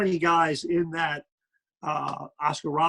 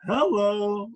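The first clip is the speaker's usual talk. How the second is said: drawn-out, higher wolf-whistle hello; admiring hello; 5 semitones higher than usual. Retold or imitated. Guy reacting to a woman walking by, admiring hello. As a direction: say hello like a pretty woman just walked by. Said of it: "you'd go".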